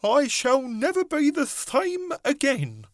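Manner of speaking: very high, slow worm voice; a tiny creature's high lament; earthworm voice. A narrator's reading. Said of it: "murmured the earthworm".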